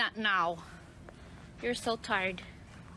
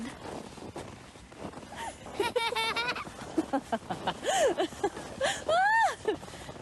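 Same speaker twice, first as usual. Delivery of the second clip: long laughter; 12 semitones higher than usual. Real, not acted. Laughing at Lily.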